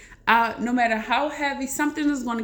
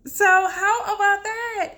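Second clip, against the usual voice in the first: amazed; 9 semitones higher, 4 dB louder, slower than usual. Real, not acted. Amazed at gold connection.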